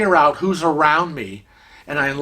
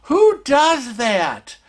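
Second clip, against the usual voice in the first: high, exasperated outburst; indignant; 9 semitones higher, much slower than usual. Retold or imitated. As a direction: stretch the question out in outrage. Indignant end of the story.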